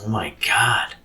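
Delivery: breathy frightened whisper of a spooked partner; voiceless "Oh my God" full of dread; scared whisper. Retold or imitated. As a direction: hush it out, spooked in the dark. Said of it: "and he's like this".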